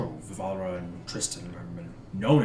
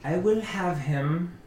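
haughty aristocrat lady; 5 semitones higher, slower than usual. Acted.

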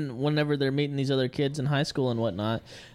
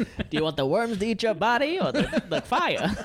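high showman patter, mocking; carnival barker; 8 semitones higher than usual. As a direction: pitch it like a fairground barker. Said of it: "That's what I sound like".

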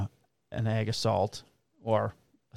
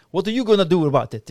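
higher, louder mock threat; threatening Polish guy; 6 semitones higher, 6 dB louder, faster than usual. Polish guy threatening the kid with a knife, lightly mimicked challenge. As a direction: imitate a man squaring up to a kid. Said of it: "he threatens the kid".

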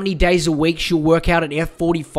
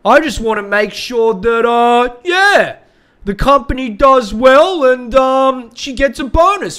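hammed-up hesitant office guy, pitched up; put-on higher voice, hemming and hawing; mock coworker excuse; 4 semitones higher, 5 dB louder than usual. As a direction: stumble through a fake-earnest excuse, stammering. Mocking the coworker's excuse for calling.

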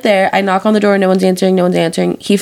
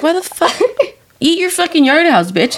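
mock-scold your friend in disbelief; exasperated, pitched-up retelling; retold exasperation; 8 semitones higher than usual.

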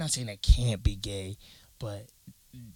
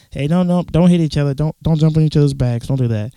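put-on louder, raised voice telling kids to stop; teacher yelling; 3 semitones higher, 9 dB louder than usual. Teacher shouting at kids hitting each other. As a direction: call out across the room to stop them.

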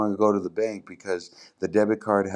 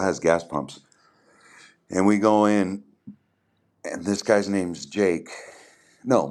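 ordinary talk, nothing marked

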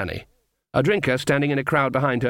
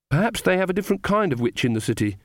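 higher-pitched, mildly forlorn suggestion; hopeless; 7 semitones higher than usual. A narrator's reading. Magrat guessing about witches in the city, hopeless. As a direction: offer it with a touch of hopelessness. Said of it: "said Magrat hopelessly".

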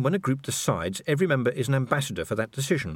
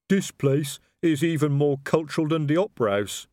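troll admiring the hall; respectful troll voice; 5 semitones higher, 3 dB louder than usual. A narrator's reading. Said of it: "gazing around respectfully".

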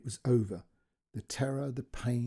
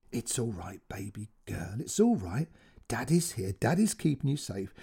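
gentle soothing; 3 semitones lower than usual. Acted. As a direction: hush her gently like a scared little girl. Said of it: "his voice gentle".

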